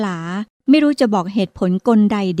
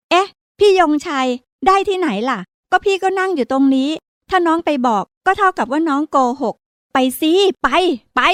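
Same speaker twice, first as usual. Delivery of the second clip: arguing back; 7 semitones higher than usual. Acted. Girl argues back with brother.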